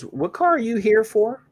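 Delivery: a high, put-on voice for the guy at the desk; lightly mock the mechanic's greeting; imitating the mechanic. Mechanic greeting him in the waiting room, high mock voice. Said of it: "He goes".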